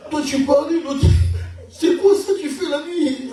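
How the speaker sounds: high, loud, laughing alarm; hammed-up fright at his friend; mock-alarmed. Confronting Trésor about the voices, high laughing alarm.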